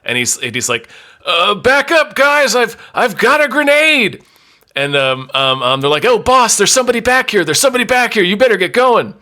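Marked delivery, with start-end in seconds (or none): 1.3-4.2 put-on panicky young man. Hidden young man threatening. 6.0-9.1 urgent henchman. Acted. Henchmen warning their boss.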